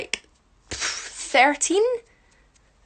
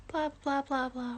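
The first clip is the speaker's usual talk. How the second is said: mock whispered blah blah; 11 dB quieter, slower than usual. Real, not acted. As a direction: trail off in a whisper.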